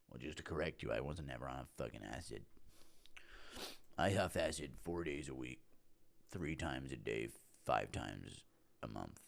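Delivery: low, quiet, halting mumbler; Tito Ortiz. Acted. Fighter on acid correcting an accusation, low quiet impression. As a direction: drop low and soft, correcting yourself haltingly. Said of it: "Impression of Tito Ortiz on acid".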